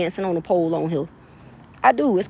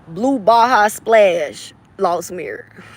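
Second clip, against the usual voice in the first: slow, drawn-out reading of a label; 2 semitones lower, slower than usual. Real, not acted. Streamer slowly reading a product label aloud. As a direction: spell it out slowly.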